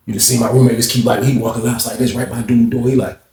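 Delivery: normal talking voice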